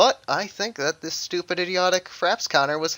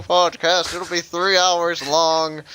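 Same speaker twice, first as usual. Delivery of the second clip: mock announcer; 3 semitones higher, 6 dB louder than usual. Opening a joke podcast to co-hosts, hammed-up announcer. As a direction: play up the announcer, stretching the ending.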